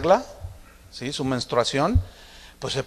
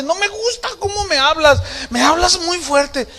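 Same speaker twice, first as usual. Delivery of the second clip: high-pitched mock complaint; whiny-high acted objection; upset daughter; 12 semitones higher than usual. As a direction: imitate a hurt daughter telling dad off. Daughter complaining to father, retold.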